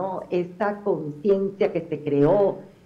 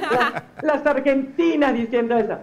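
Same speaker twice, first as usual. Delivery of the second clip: laughing; 6 semitones higher, 3 dB louder than usual. Real, not acted. Laughing at her friends' slang.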